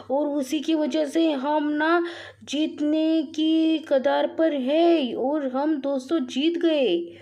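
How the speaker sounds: slow, high build-up to the win; drawn-out victory announcement. Real, not acted.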